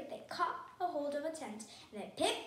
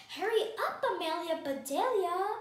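higher, louder storybook call; 4 semitones higher, 7 dB louder than usual. A narrator's reading. Mister Ruggers hurrying Amelia Bedelia along, calling out. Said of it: "called Mister Ruggers".

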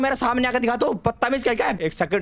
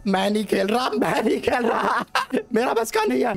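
shaken laugh. Real, not acted. Quitting after scare, laughing.

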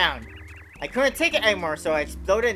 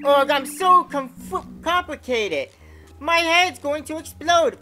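overwhelmed character voice; 8 semitones higher, 5 dB louder, slower than usual. Acted. Voicing confused Bob-omb dialogue.

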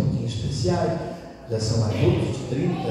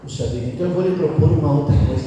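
ordinary delivery

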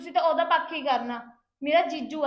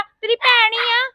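louder, each word drawn out slowly; loud, slow protest; 6 semitones higher, 11 dB louder, slower than usual. Acted. Sister loudly insisting to her elder sister that she is family. Sister defends herself in quarrel.

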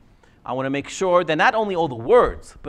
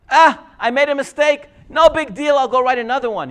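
louder, higher, breezy no-big-deal tone; mock careless shrug; 7 semitones higher, 8 dB louder than usual. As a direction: play it off as nothing, mocking. Rabbi mimics careless attitude.

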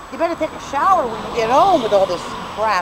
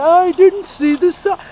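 mock excuse voice; 9 semitones higher, 7 dB louder than usual. Imagined careless parker excuse.

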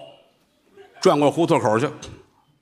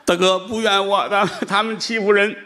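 high, pleading complaint; whiny seller; 8 semitones higher than usual. Acted. Vegetable seller whining to the nuns, high and pleading. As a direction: plead your case, pitched up.

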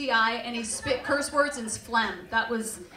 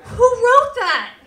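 much higher, louder, slow cry; outraged cry; 11 semitones higher, 14 dB louder, much slower than usual. Real, not acted. Comic mocking the gingerbread story, outraged cry. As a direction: demand it of the room.

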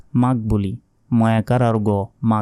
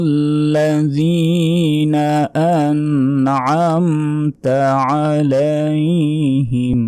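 slow, higher melodic chanting of the verse; Quran recitation; 5 semitones higher, 3 dB louder, much slower than usual. Shown as a demonstration. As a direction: draw out each word as in tajweed recitation.

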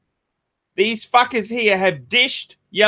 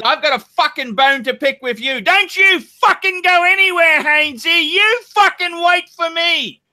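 high, heated scolding; angry threat; 7 semitones higher than usual. Real, not acted. Host warning Hainesy to wait, angry and high. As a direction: order him to stay put.

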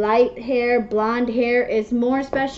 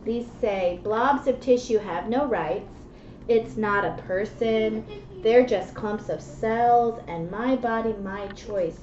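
put-on reading to children; 5 dB quieter than usual. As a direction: read the rhymes gently to a child. Reads picture book aloud.

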